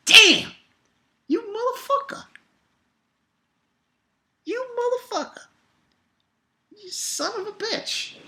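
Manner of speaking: mock-outraged fanboy squeal; squealing awe. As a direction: squeak it out, overwhelmed by the hype. Retelling his own awed reaction.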